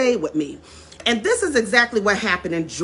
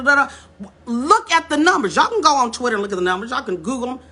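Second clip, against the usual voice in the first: ordinary talk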